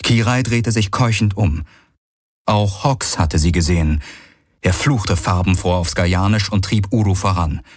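plain reading voice

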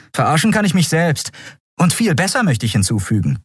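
high-pitched, disbelieving comeback; scornful retort. Acted. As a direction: snub him, pitched high then falling. (patzig, mit hoher Stimme)

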